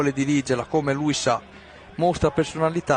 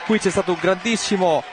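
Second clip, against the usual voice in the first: breathless italian goal commentary; 6 semitones higher than usual. Real, not acted. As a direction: erupt with joy at the goal. Goal scored, commentator erupts.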